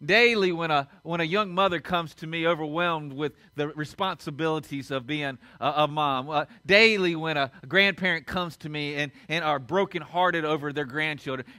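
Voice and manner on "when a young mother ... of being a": normal talking voice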